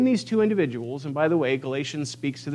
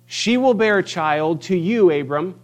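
booming proclamation; 4 semitones higher, 7 dB louder than usual. Acted. Preacher voicing El Shaddai to the congregation, booming. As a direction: proclaim it like the Almighty.

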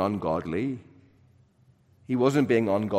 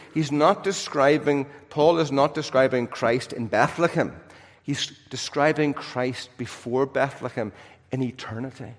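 ordinary delivery

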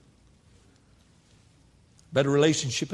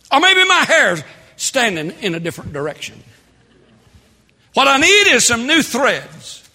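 full-force preaching; 10 semitones higher, 10 dB louder than usual. Acted. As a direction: holler each need out to the back pews.

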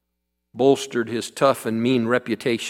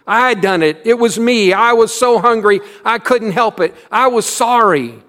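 put-on high, loud wail of a sorry boy; wailing confession; 9 semitones higher, 8 dB louder than usual. A narrator's reading. Little boy confessing the theft to the teacher, wailing high and loud. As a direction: wail it out, ashamed and hungry.

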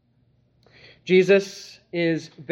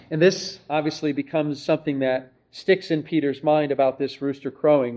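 ordinary talk

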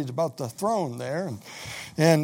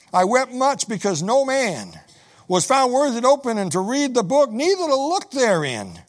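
higher, louder preaching read; preached scripture reading; 6 semitones higher, 4 dB louder than usual. Acted. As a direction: proclaim the verse to the congregation. Reads scripture aloud.

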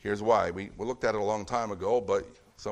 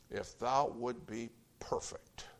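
soft whisper as Jesus; a gentle whispered offer; 8 dB quieter, slower than usual. Acted. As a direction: hush it, intimate and close. Jesus quoted to rich ruler.

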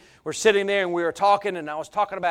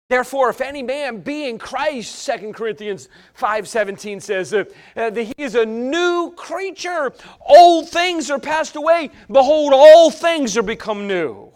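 loud, higher, driving sermon; preaching at full force; 6 semitones higher, 8 dB louder than usual. Acted. Preacher warning the church about emotions, preaching at full force. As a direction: hammer the point to the congregation.

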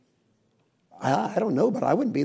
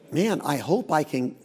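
ordinary talk, nothing marked

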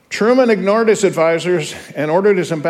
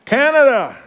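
called out much higher; roll call announcer; 9 semitones higher, 3 dB louder than usual. Acted. Teacher reading the roll call for Canada, called out higher. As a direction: ring out the country's name.

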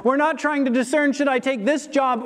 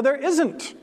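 normal talking voice

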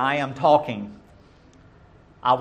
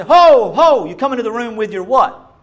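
much higher and louder halt; stern father's shout; 9 semitones higher, 11 dB louder than usual. Retold or imitated. Father halting a child who runs in talking, stern loud call. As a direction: stop the child in their tracks. Father stopping kids interrupting.

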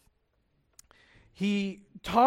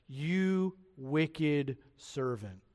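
very slow, low-key and softer, word by word; grave condemnation; 4 semitones lower, 6 dB quieter, much slower than usual. Acted. King naming the failed servant, gravely condemning. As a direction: pronounce judgment slowly and quietly.

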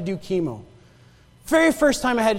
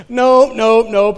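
loud mock refusal; hammed-up nope, nope, nope; comic refusal; 6 dB louder, much slower than usual. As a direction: bail out of the room, loudly.

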